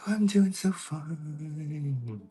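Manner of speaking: high, slow, lightly fairy-like. Acted. [name] answering Diane in a slow high fairy voice. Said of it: "a fairy voice".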